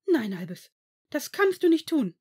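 loud, high, urgent objection; alarmed protest. A narrator's reading. McGonagall jumps up to stop Albus, alarmed. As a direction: cry out against it.

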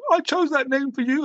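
high-pitched imitation of his hurt mother; mock-hurt mother voice; upset mum. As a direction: squeak it out like a wounded mum. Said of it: "She's like".

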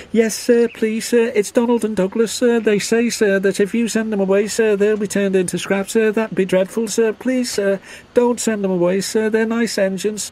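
very high, hurried squeaky voice; nervous little engine, pleading. A narrator's reading. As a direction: blurt it out nervously to the boss. Percy nervously pleads for twins.

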